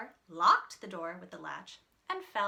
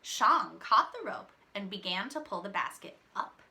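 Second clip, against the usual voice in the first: ordinary delivery, nothing marked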